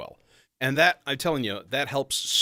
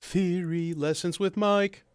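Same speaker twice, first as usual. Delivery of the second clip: mock announcer; 8 semitones higher, slower than usual. Announcing a segment title.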